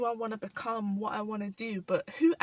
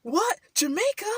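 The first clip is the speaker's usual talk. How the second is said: high, loud delighted what; excited disbelief; 10 semitones higher, 6 dB louder than usual. Retold or imitated. Locals hearing she's half Jamaican, high excited surprise. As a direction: squeal it like thrilled strangers.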